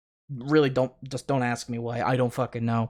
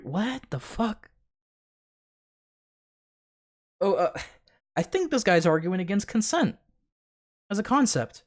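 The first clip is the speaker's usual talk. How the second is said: disbelief; 6 semitones higher than usual. Real, not acted. Hearing an argument against consent.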